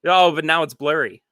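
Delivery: ordinary talk, nothing marked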